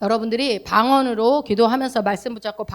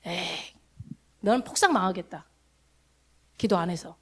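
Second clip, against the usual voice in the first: softer low dismissive curse; mock doom prophecy; 5 semitones lower, 8 dB quieter than usual. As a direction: mutter a gloomy doom. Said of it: "근데 봐봐". (낮고 작게 비웃듯)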